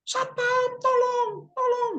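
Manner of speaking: very high mock cry to the guard; calling for help. Girl calling to the security guard, high mock cry. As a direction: falsetto call for the security guard. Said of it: "dia teriak sama Pak Satpam".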